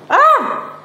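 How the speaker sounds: sharp high cry of pain; pained yelp. Real, not acted. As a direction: yelp as the finger gets pinched. Finger pinched in tripod.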